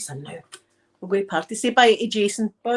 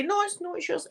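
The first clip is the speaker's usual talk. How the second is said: acted argument reply; 10 semitones higher, 4 dB quieter than usual. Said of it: "see you're arguing with someone".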